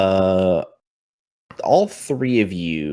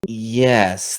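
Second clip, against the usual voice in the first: ordinary talk, nothing marked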